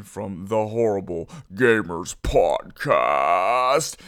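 low, drawn-out announcer voice; mock announcer. Plugging a friend's podcast, mock announcer. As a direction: stretch the show title out slowly and low.

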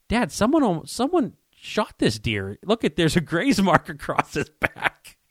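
high, slow, amazed with a laugh; stunned hunter showing dad; excited discovery. Retold or imitated. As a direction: point it out in disbelief. Discovering graze mark, telling dad.

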